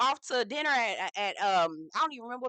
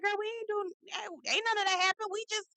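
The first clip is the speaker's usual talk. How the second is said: exasperated retort; 10 semitones higher than usual. Retold or imitated. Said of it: "I was like".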